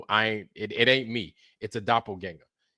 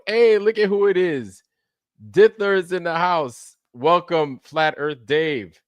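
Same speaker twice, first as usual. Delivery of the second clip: excited welcome; 5 semitones higher, 5 dB louder, slower than usual. Real, not acted. Excited greeting of a regular viewer.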